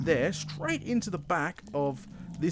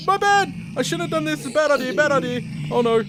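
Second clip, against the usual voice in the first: much higher, loud frantic yelling; high, loud panicked shrieks of real alarm; panicked screaming; 7 semitones higher, 10 dB louder than usual. Real, not acted. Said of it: "Screaming like a girl right there".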